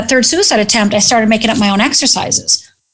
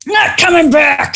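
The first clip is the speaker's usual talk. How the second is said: defiant refusal; 5 semitones higher, much slower than usual. Retold or imitated. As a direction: yell it back, refusing. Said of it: "And I'm like".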